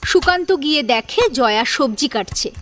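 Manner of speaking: usual speaking voice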